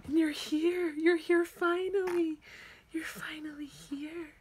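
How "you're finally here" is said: real tender whisper. Real, not acted. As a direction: breathe it gently. Whispering to newborn.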